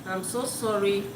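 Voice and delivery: usual speaking voice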